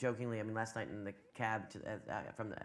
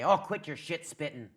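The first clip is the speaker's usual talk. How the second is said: put-on slow, louder Irish-accented old lady; 2 semitones higher, 5 dB louder than usual. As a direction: put on an Irish lady's brogue. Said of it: "an old Irish lady say".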